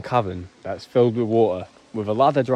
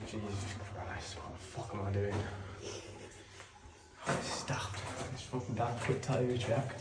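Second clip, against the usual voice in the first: hushed nervous; 17 dB quieter than usual. Real, not acted. Nervous before icy plunge.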